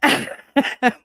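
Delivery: high, loud huffy "mm"; short mock-tantrum hum; pouty grunt. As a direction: grunt it with a foot stamp. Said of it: "I stamp my heel".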